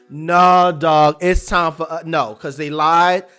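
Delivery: loud, defiant refusal. Retold or imitated. Shirley rejects calls to calm down, loud and defiant. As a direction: plant your feet and refuse.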